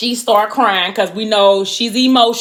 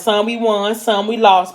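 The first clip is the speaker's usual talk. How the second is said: normal talking voice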